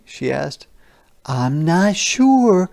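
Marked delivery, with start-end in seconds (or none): 1.2-2.7 unsure mom. Acted. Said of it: "Mom explained".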